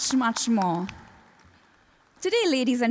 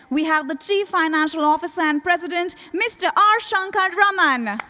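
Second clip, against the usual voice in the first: raised, higher-pitched announcer call, stretching the name; rousing announcer introduction; 5 semitones higher, 4 dB louder than usual. Real, not acted. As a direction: announce the honored guest with a big build. Emcee introducing dignitary to applause.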